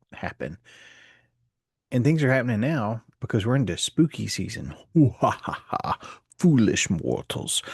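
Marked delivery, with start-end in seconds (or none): 5.0-6.0 spooky villain laugh; dark lord. Pretend. Spooky season intro, ghost laugh. 6.4-7.6 slow, spooky ghost host; drawn-out haunted mansion greeting; dark lord. Pretend. Spooky season, Haunted Mansion line.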